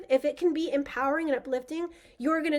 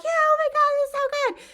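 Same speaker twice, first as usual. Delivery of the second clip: high, loud gushing audience voice; cheering crowd; 13 semitones higher, 5 dB louder than usual. Retold or imitated. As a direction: play the clapping crowd raving over the speech. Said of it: "they were all like".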